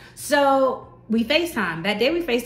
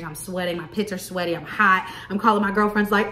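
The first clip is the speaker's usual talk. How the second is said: usual speaking voice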